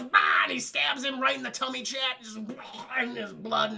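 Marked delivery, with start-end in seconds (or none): 0.0-0.4 loud sharp shout; battle shout. Retold or imitated. Indigo yelling as he stabs the six-fingered man. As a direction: bark it as the sword goes in. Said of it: "Indigo Montoya goes".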